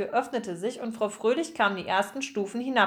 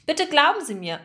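higher, louder earnest appeal; pleading; 6 semitones higher, 5 dB louder than usual. A narrator's reading. Margarete to sceptical Frau Fröhlich, pleading. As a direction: beg to be believed.

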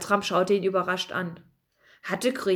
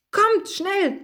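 higher, urgent call; 10 semitones higher than usual. Acted. Bertram urges Pikandu to jump, urgent high call. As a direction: call up to him, hurry him. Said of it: "rief Bertram".

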